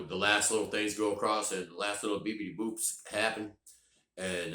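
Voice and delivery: ordinary talk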